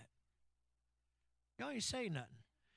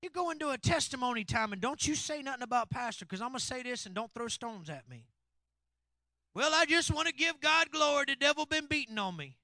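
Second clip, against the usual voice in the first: loud, driving sermon delivery; louder and louder from the pulpit; full-force preaching; 9 semitones higher, 16 dB louder than usual. Acted. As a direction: preach it hard to the congregation.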